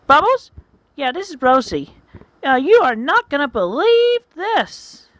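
high, emphatic phone-gossip voice; gossiping friend. Acted. Rosie calling a friend with news, gossiping voice. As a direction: pitch it up and relish the big news.

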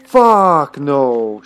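loud exclamation of dismay; dismayed outburst. Real, not acted. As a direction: burst out loudly at your own slip. Realises a cabinet was left locked.

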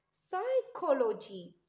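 "سايكولوجي" slow, with a hard k; k sound. Shown as a demonstration. Teacher modelling the k sound, "سايكولوجي" slow and clear. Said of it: "ك ساوند".

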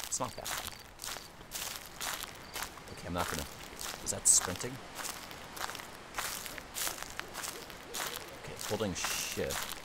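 very soft, low murmur; hushed, wary. Real, not acted. Player testing controls in a horror game, hushed and wary. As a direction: murmur it low, almost to yourself.

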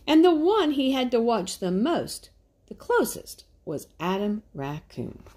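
animated storybook narration; story narration. Acted. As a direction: read it like a picture book for little ones. Reads picture book aloud.

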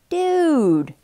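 mildly wounded protest; understated hurt friend; almost hurt. A narrator's reading. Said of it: "sounding almost hurt".

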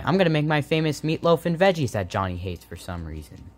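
ordinary talk, nothing marked

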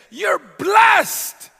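booming, high, loud proclamation; voice of God. Acted. God declaring the believer blessed, booming and loud. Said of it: "And God says".

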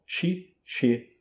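"Cice" as chee, cheh; two separated soft ch sounds in "Cice"; Italian syllables. Shown as a demonstration. Teacher repeats Italian syllables for students, ch sounds in "Cice". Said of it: "pronunciadas em italiano".

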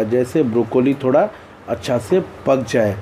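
usual speaking voice

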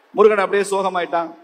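ordinary delivery, nothing marked

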